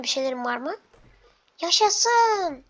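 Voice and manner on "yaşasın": delighted cheer. Acted. Finds food in the fridge.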